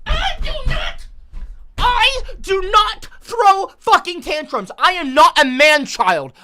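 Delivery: slow, high, very loud tearful screaming; crying furious shout. Real, not acted. Streamer denying he throws tantrums, tearful screaming. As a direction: scream the denial through real tears.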